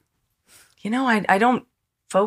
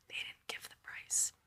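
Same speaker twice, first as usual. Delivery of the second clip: whisper; 24 dB quieter than usual. Retold or imitated. Whispering aside to the manager.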